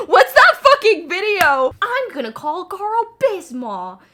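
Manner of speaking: high, very loud quoted outburst; over-the-top high-pitched shouting; yelling impression. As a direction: yell it at the top of your voice. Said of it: "Why am I yelling?".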